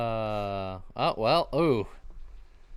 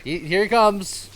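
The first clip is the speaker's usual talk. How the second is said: dramatic announcement; 8 semitones higher, 7 dB louder than usual. Acted.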